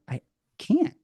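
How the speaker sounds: high, squeaky retold protest; a lightly hammed-up panicked "I can't"; whiny protest. Retold protest to his teacher.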